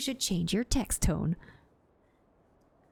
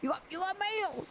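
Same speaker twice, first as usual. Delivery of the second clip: attempt at gruff; 8 semitones higher than usual. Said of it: "like, gruff?".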